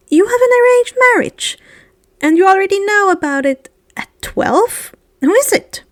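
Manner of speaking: high, astonished questions; can't believe what he's hearing; shocked. Acted. Harry reacts to Air's arranged marriage, high and astonished.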